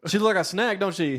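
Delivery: loud, high mock-youth slang; put-on slang voice. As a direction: ham up trying to sound like the kids. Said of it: "I coulda used one of my slang terms".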